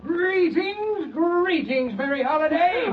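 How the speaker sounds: high, sing-song clown calling greetings. Acted. Clown calling greetings at Mary's door, high and sing-song.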